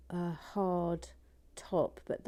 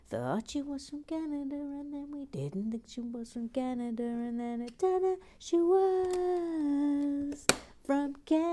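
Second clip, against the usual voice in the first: high, drawn-out showman announcing; announcer voice; 8 semitones higher than usual. Acted. Presenter grandly announcing a viewer to the chat. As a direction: build it up like a game show host.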